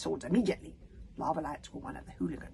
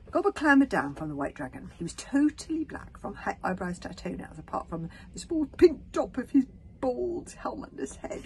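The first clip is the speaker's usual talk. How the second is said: usual speaking voice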